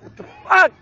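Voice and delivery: high loud startled cry; shocked outburst. Real, not acted. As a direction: blurt it out in shock.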